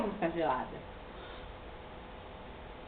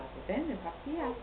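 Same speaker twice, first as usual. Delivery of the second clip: usual speaking voice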